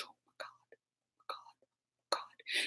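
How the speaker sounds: breathless whispered oh my God; panicked whisper. Retold or imitated. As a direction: whisper it in pure panic, barely any voice. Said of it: "I said".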